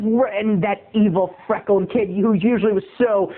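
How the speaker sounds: normal talking voice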